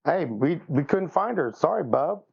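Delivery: normal talking voice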